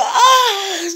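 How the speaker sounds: high, loud drawn-out "ah"; reviving cry. Acted. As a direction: cry out as if jolted back to life. La chica muerta revive gritando. (grito agudo al revivir)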